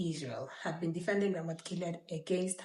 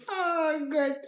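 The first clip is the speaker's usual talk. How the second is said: high, loud startled "oh God"; shocked gasp; 9 semitones higher, 6 dB louder than usual. Real, not acted. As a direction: blurt it out in real surprise. Reacts to the dramatic passage.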